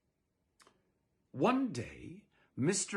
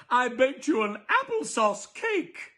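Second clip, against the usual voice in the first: much higher, louder bubbly welcome; cheery neighbor lady; 11 semitones higher, 7 dB louder, slower than usual. Acted. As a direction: greet the new neighbors with big sunny cheer.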